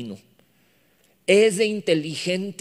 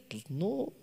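small, much softer admission; sheepish kid; 3 semitones higher, 10 dB quieter than usual. Acted. Kid admitting ignorance to parents, meek and soft. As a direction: admit it meekly under your breath. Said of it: "¿no sabes hacer?".

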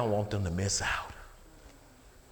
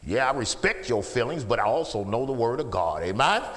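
ordinary delivery

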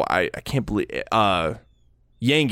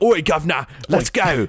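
mock British accent; 5 semitones higher than usual. Friend mocking the sidekick's British accent, hammed-up cockney. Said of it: "He's just like".

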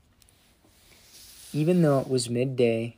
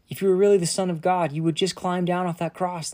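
mocking taunt; 6 semitones higher, 4 dB louder, faster than usual. Mockers taunting Jesus on cross.

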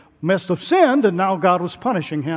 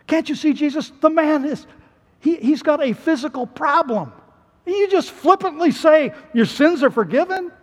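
high-pitched, disbelieving objection; incredulous protest; 9 semitones higher than usual. Acted. Onlooker challenging Jesus about the paralysed man, incredulous. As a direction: plead the obvious in disbelief.

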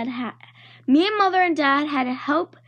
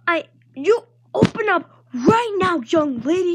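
mild mock yell; 4 semitones higher than usual. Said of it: "she yelled".

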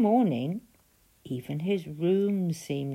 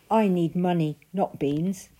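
put-on louder, insistent boy; Jack protesting; 3 semitones higher, 7 dB louder than usual. A narrator's reading. Jack refusing the strange man's offer, loud and insistent. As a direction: push back hard on the deal. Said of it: "said Jack".

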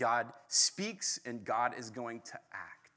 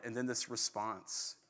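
ordinary talk, nothing marked

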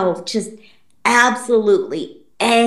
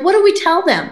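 panicky mom; 7 semitones higher, faster than usual. Retold or imitated. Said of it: "She's like".

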